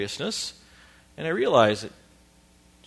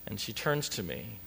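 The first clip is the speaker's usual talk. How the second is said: normal talking voice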